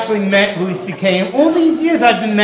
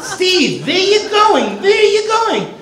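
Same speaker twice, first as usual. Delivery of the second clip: mock Yiddish-European accent, much higher; mother's European accent; 9 semitones higher, slower than usual. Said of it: "she died with a European accent".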